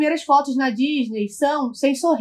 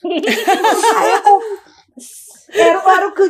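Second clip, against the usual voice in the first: laughing; 6 semitones higher, 6 dB louder than usual. Real, not acted. Laughing at her own story.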